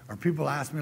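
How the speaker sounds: ordinary delivery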